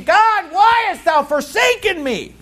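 high, slow, a touch of anguished crying out. Retold or imitated. Preacher voicing Jesus on the cross, high anguished cry. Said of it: "he's crying out saying".

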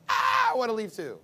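high, loud whine of a fed-up husband; mock the husband wanting to leave, loud and high; whiny husband. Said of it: "he's like".

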